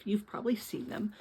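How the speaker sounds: whispered aside to the listener. Real, not acted. Reader whispering to young listeners to think. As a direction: drop to a hushed whisper. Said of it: "think to yourself".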